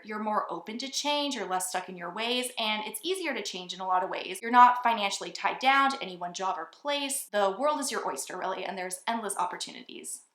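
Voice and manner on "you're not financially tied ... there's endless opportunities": ordinary delivery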